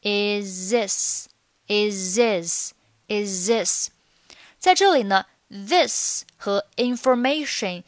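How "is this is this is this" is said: "is this" slowly joined as "i-zis"; linked words. Shown as a demonstration. Teacher drilling linking, "is this" slowly run together. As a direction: glide from "is" straight into "this". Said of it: "连读成".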